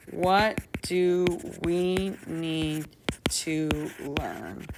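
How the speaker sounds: WHAT DO WE NEED drawn out word by word; slow repetition. Shown as a demonstration. Teacher repeats the lesson's question while drafting, slow and deliberate. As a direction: stretch out "What do we need to learn" slowly.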